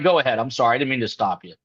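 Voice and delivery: usual speaking voice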